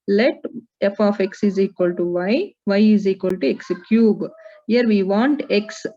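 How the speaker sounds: ordinary talk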